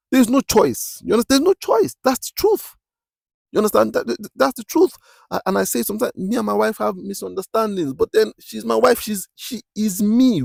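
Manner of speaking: very high-pitched, insistent preaching; impassioned preaching. Real, not acted. Preacher declares husband and wife are one, impassioned. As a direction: drive the truth home, pitch held way up. Preaches emphatically about commitment.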